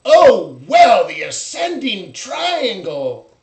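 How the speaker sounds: loud, high, slow mock objection; hammed-up shout of a pattern-spotter; mocking know-it-all. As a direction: lampoon the smug chart trader. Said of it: "Now you're gonna say".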